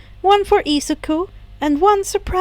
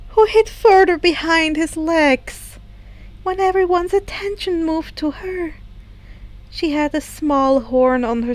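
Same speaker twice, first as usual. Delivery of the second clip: usual speaking voice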